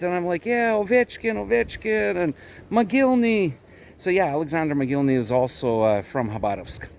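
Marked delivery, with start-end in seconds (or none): 0.5-3.5 higher-pitched eager agreement; retold enthusiasm. Retelling his hockey chat with the driver, enthusiastic. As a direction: re-enact keen hockey chat.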